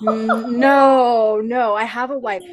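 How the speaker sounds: retold refusal, pushed out loud; mock firm no. Grandfather telling the little girl he already has a wife, loud and firm.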